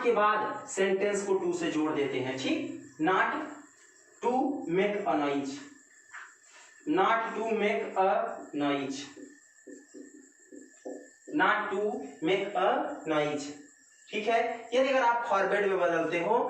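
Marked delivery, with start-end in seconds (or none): 3.0-13.5 slow dictation. Shown as a demonstration. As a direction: answer drawn out word by word. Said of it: "dictating the answer".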